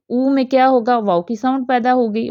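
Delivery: ordinary delivery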